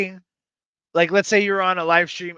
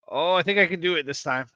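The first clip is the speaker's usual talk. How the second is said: ordinary delivery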